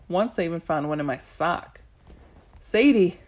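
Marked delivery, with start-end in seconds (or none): 2.6-3.2 louder urgent call of the name; a goddess calling the girl urgently in battle. A narrator's reading. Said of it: "Bast called urgently".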